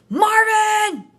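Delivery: loud, high angry call; yelled. A narrator's reading. Angry sister May yelling for Marvin, loud and high. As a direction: shout his name across the farm. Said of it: "she yelled".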